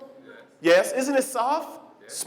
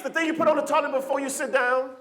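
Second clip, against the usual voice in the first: ordinary delivery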